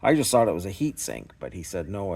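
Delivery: normal talking voice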